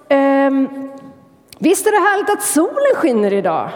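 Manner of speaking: high, light, sunny voice; cheerful swallow. Acted. Swallow tells hedgehog about sunshine, high cheerful voice. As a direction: delight in the sunny day. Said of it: "hon sa".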